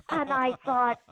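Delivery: ordinary delivery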